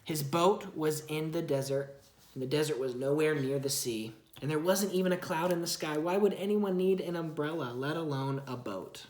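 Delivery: storybook narration for a little one; reading to a child. Acted. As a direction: read it gently to your child. Parent reads Bible story aloud.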